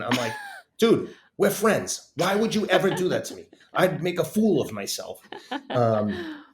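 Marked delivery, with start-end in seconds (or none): 0.8-5.2 higher, louder mock-exasperated objection; retold indignant protest. Protesting to a friend who suggested the show, indignant. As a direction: protest to a pal, pitched up and loud.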